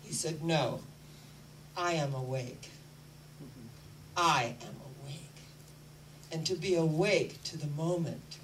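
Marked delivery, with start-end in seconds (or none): 0.4-5.4 calm reply. Retold or imitated. Buddha's calm answer.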